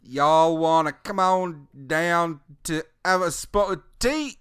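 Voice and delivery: hillbilly Brit mashup. Acted. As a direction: ham up a hillbilly asking you to tea. Mixing hillbilly and British.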